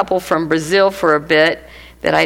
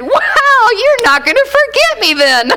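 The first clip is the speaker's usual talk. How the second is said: high, loud laughing quip; real nervous giggle; 5 dB louder than usual. Real, not acted. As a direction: laugh nervously through the joke. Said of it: "I laugh when I shouldn't laugh".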